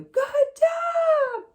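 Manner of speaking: very high, loud, gushing good job; squealed praise, stretched out. Shown as a demonstration. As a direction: shriek it with delight for the dog. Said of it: "very high frequency".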